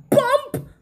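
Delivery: high, loud exclaimed bump; sudden thud. A narrator's reading. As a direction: punch the word out high. Sound word for the fall.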